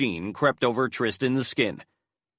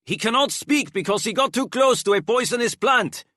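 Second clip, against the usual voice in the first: high, raised voice calling out; shouted; 11 semitones higher, 3 dB louder than usual. A narrator's reading. Enrique shouts to the guards, high and urgent. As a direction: shout it through the door, thinking fast. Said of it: "shouted Enrique".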